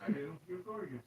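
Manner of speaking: distant, off-mic teasing; far off. Real, not acted. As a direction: tease from across the room.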